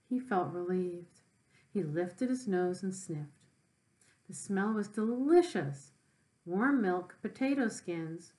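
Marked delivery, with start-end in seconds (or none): none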